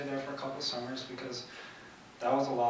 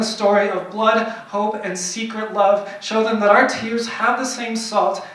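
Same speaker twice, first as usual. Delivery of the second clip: forceful, higher-pitched spoken-word delivery; poem recital; 10 semitones higher, 14 dB louder than usual. Acted. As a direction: build the poem with loud, insistent emphasis. Performs poem building in force.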